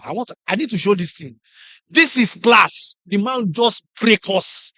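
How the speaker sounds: ordinary talk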